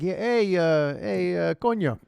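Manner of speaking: halting, hammed-up Bronx guy; attempted Bronx accent. Guy in the Bronx checking on another, in a shaky attempt at a Bronx accent. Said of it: "How many times in the Bronx it's like".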